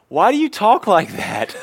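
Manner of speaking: put-on high, slow, giggling little girl; laughing child's voice. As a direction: imitate a toddler while laughing. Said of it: "Lydia says".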